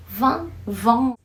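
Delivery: Van, vão said slowly side by side; van versus vão. Shown as a demonstration. Teacher contrasting van and vão for students. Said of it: "diferença entre a pronúncia".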